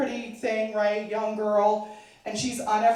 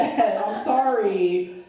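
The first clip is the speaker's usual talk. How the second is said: ordinary delivery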